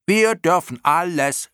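very high, loud, drawn-out yell; shrieking moth. A narrator's reading. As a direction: yell it out like a defiant little clown moth. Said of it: "schrie eine andere". (hoch und gedehnt schreiend)

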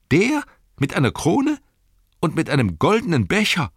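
high, loud incredulous questioning; utter astonishment. Acted. Innkeeper imagines ranger with crown and goblet, utter astonishment. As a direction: voice shooting up in disbelief. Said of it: "ein Bild sprachlosen Staunens".

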